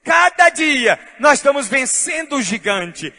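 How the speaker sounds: loud, chanted preaching build; preaching at full force. Real, not acted. Pastor rousing the congregation, loud chanted preaching. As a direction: preach it out, ringing and triumphant.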